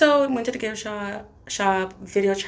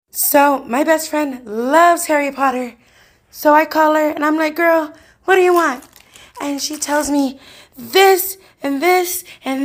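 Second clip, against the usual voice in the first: loud telling; 5 semitones higher, 8 dB louder than usual. Real, not acted. Heated retelling of friend call.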